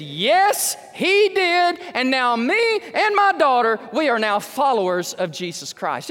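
mother's joyful declaration, pitched high and loud; excited mother. Retold or imitated. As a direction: proclaim it with a mother's thrilled joy. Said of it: "her mom got all excited".

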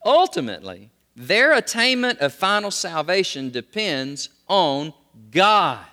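repeated emphatic stress; stress on ultimately again. Shown as a demonstration. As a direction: drive ultimately home. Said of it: "one more time".

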